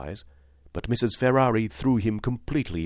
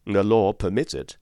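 answered gravely; 3 semitones higher, 7 dB louder than usual. A narrator's reading. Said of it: "Mister Troy answered gravely".

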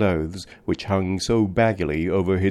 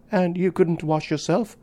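put-on much higher child voice, serious question; a small child interrupting in earnest; grave little girl; 10 semitones higher than usual. A narrator's reading. Little girl to the grimy man, high serious child voice. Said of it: "interrupted his companion gravely".

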